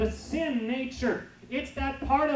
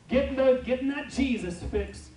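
ordinary delivery

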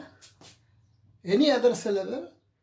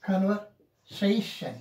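slow "Conversation", split before "-sation"; measured "Conversation", weight on "-sa-"; primary and secondary stress; slower than usual. Shown as a demonstration. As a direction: demonstrate "Conversation" as con-ver, SA-tion. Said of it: "this is primary and this is secondary".

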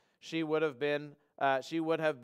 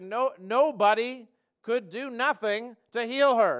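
"nobody could do nothing" slow and pressed, louder; emphatic double negative; 5 semitones higher, 5 dB louder, slower than usual. Shown as a demonstration. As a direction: lean hard on "nobody" and "nothing". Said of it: "a double negative is emphatic".

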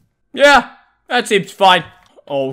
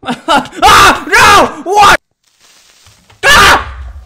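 high, loud dismayed yells; screaming; 9 semitones higher, 9 dB louder, much slower than usual. Real, not acted. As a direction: scream at the sudden loss. Game disaster, screaming.

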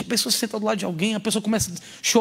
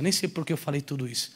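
ordinary talk, nothing marked

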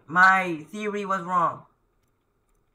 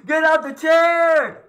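yelling louder and higher in real panic; frantic yell at the game; panicked shout; 7 semitones higher, 8 dB louder, slower than usual. Real, not acted. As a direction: scream at her to get out of the way.

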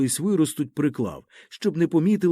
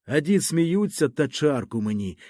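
usual speaking voice

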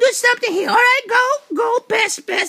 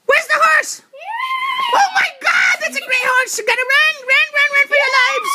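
excited shout; 6 semitones higher than usual. Real, not acted. Host shouting as the big horse charges by, excited. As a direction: yell as if the horse will trample you.

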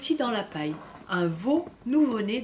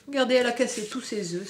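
ordinary delivery, nothing marked

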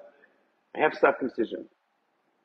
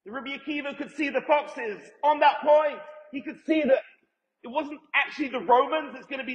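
fervent preaching shout; 10 semitones higher than usual. Real, not acted. Rabbi to his class on end of days, fervent shout. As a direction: proclaim it like a revelation to the room.

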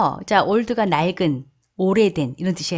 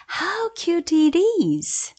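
much higher, slow gush; cooing exclamation; 9 semitones higher, much slower than usual. Shown as a demonstration. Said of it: "하시면 됩니다". (높게 감탄하며 천천히)

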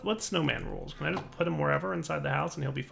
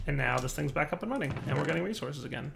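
normal talking voice

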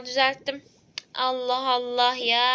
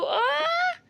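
sudden shrill cry of pain; patient yelping in fright; high pain scream. Acted. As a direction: shriek as the drill comes.

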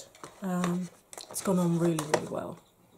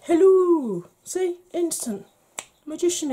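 excited; 9 semitones higher, 11 dB louder than usual. Real, not acted. Bursting back in after the cut.

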